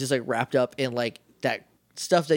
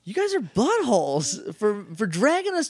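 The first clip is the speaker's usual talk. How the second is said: exasperated; 10 semitones higher than usual. Real, not acted. Mocking the show's water plot.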